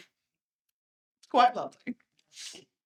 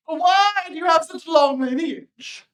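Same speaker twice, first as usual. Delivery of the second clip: raised voice, pressing the question; a general interrogating his servant; stern interrogation; 6 semitones higher, 6 dB louder than usual. Retold or imitated. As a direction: grill the private with suspicion. General questioning private in story.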